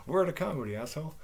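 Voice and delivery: laughing through a mock retort; imitated loud laughing. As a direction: act out her defiant laugh. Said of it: "she started laughing loud".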